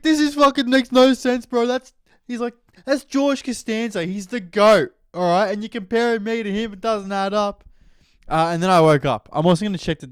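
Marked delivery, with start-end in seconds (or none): 0.0-7.6 high put-on voice of a fed-up coach; exasperated coach. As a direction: rant like a coach who can't believe it.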